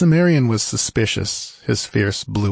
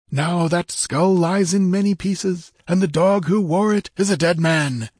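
high voice edged with savage triumph; triumphant warrior, pitched high; fierce exultation; 9 semitones higher than usual. Acted. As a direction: gloat over a dead enemy in a high character voice. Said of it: "indescribably fierce exultation edged the last sentence".

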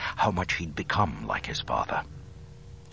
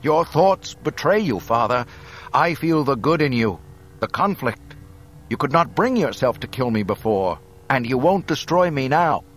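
raised, forceful appeal to a father; young Luke, earnest conviction; 7 semitones higher, 6 dB louder than usual. A narrator's reading. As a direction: insist there is still good in him. Luke pleads with Vader.

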